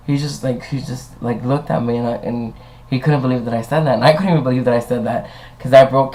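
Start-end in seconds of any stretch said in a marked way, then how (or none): none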